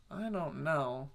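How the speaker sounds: a half-hearted, baffled mumble; mumbled unsure reply. Retold or imitated. Viewer unsure about the answers.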